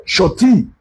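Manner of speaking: "shorty" with an aw vowel and no middle syllable; mispronounced "shorty". Shown as a demonstration. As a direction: say "shorty" in two syllables, SHAW-tee. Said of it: "pronunciation of that word is surety".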